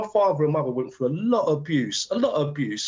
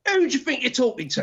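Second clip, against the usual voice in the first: mum confronting skinheads; 8 semitones higher than usual. Retold or imitated. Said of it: "My mum".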